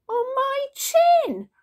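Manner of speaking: slow, high, loud yelp; pained wail. A narrator's reading. Dad kicked by baby, loud mock-pained cry. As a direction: wail it high, drawn out and falling.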